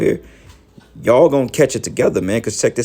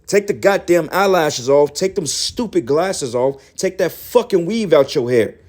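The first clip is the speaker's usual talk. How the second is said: higher-pitched, exasperated scolding; real fed-up preaching rant; 6 semitones higher than usual. Real, not acted. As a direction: berate them to wake up, voice pitched up. Berating viewers to wake up and repent.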